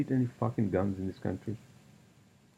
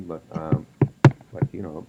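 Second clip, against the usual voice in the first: normal talking voice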